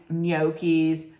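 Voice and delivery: "gnocchis" as NYOH-keez; slow demo of "gnocchis", NYOH-keez; common mispronunciation. Shown as a demonstration. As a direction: say "gnocchis" with a long oh after ny. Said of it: "most people would say it".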